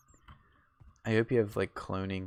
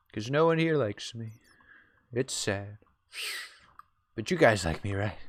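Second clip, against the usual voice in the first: Trump impression; 4 semitones higher than usual. Mock Trump speech to stream.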